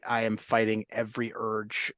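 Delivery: ordinary talk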